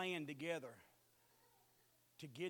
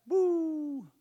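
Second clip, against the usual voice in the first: high loud joyful whoop; loud preacher's whoop; 8 semitones higher, 14 dB louder than usual. Real, not acted. Preacher whooping to the church, high and loud. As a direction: whoop for joy.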